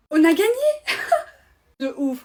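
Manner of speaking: very loud, high yell of triumph ending in a squealing laugh; victory shout. Acted. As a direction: scream the win and burst out laughing. Winning the FIFA match.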